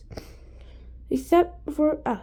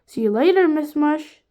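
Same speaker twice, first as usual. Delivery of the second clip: put-on boy voice; 3 semitones higher, 7 dB louder than usual. A narrator's reading. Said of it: "said the voice of Eric Ovens".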